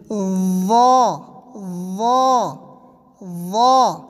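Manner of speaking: وا with buzzed th opening into thick aw; tongue out, buzz, then swell loud on وا; loud, full-mouthed وا, evenly repeated; slight buzz, heavy. Shown as a demonstration. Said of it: "تھوڑی سی جھنجھناہٹ کے ساتھ".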